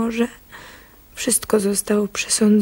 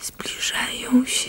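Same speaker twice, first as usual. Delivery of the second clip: slow whisper; 3 semitones higher, 5 dB quieter, slower than usual. Acted. Trackers approaching.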